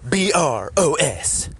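slow, loud announcer plug; drawn-out announcer sign-off; hammy announcer. Acted.

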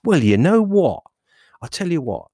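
loud, high, swooping reply; the other inner voice. Acted. The devil answering an offer of bananas, high and emphatic. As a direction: strike up a bargain in a high, theatrical voice. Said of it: "the other one kinda goes".

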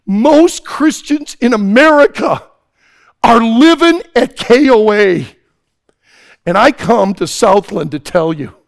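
loud, high preaching. Acted. Preacher urging teens to be all in: full-force preaching. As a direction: drive it home to the crowd.

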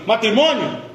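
ordinary delivery

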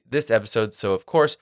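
ordinary talk, nothing marked

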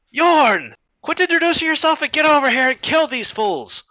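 high, scolding call across a fight; exasperated yell. Acted. Woman fighter ordering Yorn to kill these fools, exasperated high yell. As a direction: shout impatient orders at a showboating ally.

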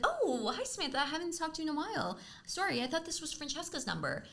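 high, soft, mock-polite phone voice; switched-up receptionist. Receptionist realizing she called the wrong sister, imitated sugary politeness. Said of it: "switched up".

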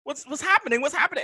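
rising mock panic; alarmed. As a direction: panic at the screen. Panic as anime turns violent.

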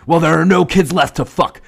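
high, loud exasperated yell; frantic shout. Acted. Henchman yelling back about missing kids, exasperated shout. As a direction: shout it back, at wit's end.

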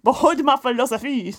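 grand, hammed-up French accent; bad French accent. Host repeats partner's line as a French philosopher, bad French accent. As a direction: declaim it in a terrible French accent. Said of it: "A bad French ac".

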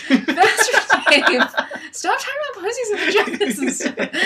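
high, laughing mock scold through giggles; scolding him while cracking up. Real, not acted. To Pastor Dave, a laughing mock scolding.

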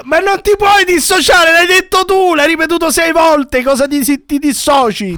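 sbotta con voce altissima; rimprovero indignato. Acted. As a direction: incalzalo indignato, voce tutta in alto. Rimprovera chi finge di dissociarsi. (sfuriata indignata, acuta)

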